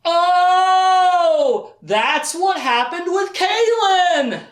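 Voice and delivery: loud, very high, drawn-out melodramatic cries; hammed-up lovestruck epiphany, almost sung; overjoyed realization. Richard realizing Kaylin loves him, hammed-up ecstatic wail. Acted sudden realization of love.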